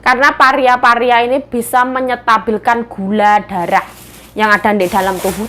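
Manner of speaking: ordinary delivery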